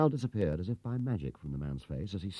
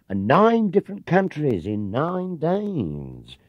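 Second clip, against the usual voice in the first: higher, louder and slow, oversold; gushing sales pitch; 9 semitones higher, 6 dB louder, much slower than usual. Acted. Clerk hyping the tour to Paddington, gushing. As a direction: sell the dream big.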